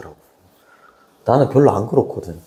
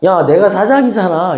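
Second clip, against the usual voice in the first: mock bossy boss, higher and louder; 8 semitones higher, 5 dB louder than usual. Teacher mimics a boss asserting rank, loud and higher. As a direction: puff up like a boss barking at staff. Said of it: "직장에서는 또 뭐라고 얘기해".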